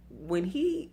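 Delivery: ordinary talk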